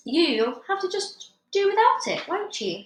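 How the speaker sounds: high, loud dismissive wife; tart wife. Acted. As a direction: tell him off tartly. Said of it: "his wife told him".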